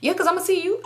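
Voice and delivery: high, airy pretend casualness; playing it cool. Hiding the argument from company, fake casual cheer. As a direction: chirp it as if nothing is wrong. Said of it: "I would play it so cool".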